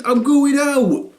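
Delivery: put-on slow high sulky teen. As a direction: drag it out sulkily. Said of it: "He said".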